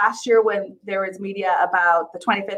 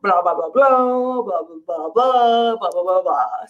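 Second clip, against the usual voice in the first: mock blah-blah babble, rising and falling; singsong string of nonsense syllables; imitated improvised babble; slower than usual. Retelling Ross's improvised bit, mock babbling in waves. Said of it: "he improvised the blah".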